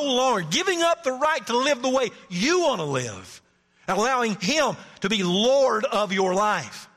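climbing sermon intensity; preaching. Acted. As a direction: preach it hard to the congregation. Pastor preaching at full force.

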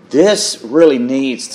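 ordinary delivery

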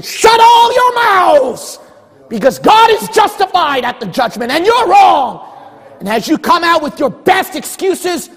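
loud, high-pitched pulpit shouting; thundering preacher shout. Real, not acted. Preacher warning of the judgment seat, full-force shout. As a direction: thunder the verdict at the congregation.

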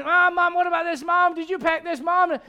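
put-on high, loud, insistent child calling Mom; pestering kid. Kids pestering Mom as the family leaves, insistent. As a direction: pile on the demands like a nagging kid.

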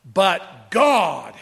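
"God" drawn out and loud, falling; heavy, forceful stress on "God"; emphatic. Shown as a demonstration. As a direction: let "God" land as a massive statement. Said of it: "a massive statement in the emphatic".

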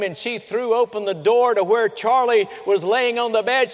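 normal talking voice

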